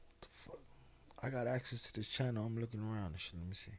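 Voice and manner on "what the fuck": whisper. Real, not acted. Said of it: "whispered aside".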